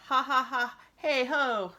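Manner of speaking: high, loud woodpecker laugh; crazy laugh. Retold or imitated. Teacher reading Melvin's crazy laugh from the book, high cartoon laugh. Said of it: "Melvin's crazy laugh".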